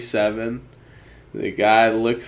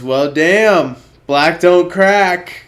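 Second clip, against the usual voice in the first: amazed exclamation; 5 semitones higher, 6 dB louder, slower than usual. Retold or imitated. Guy reacting to age reveal.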